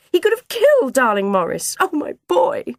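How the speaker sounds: high, loud wail over her son; distraught mother. A narrator's reading.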